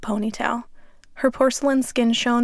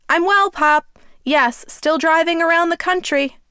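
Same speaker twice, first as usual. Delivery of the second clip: raised loud voice; 6 semitones higher, 5 dB louder than usual. A narrator's reading. Shouting to deaf grandfather.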